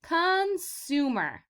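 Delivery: slow, drawn-out "consumer"; slow model word. Shown as a demonstration. Teacher models a new word slowly for kids to repeat. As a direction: stretch out "consumer" for them to echo. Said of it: "say it again".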